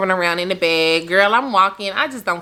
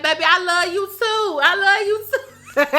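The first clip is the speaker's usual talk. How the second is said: high, giggling love reply; laughing mock yell; 11 semitones higher than usual. Teasing her boyfriend with a laughing mock reply. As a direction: gush it back through laughter. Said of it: "I wanted to yell back".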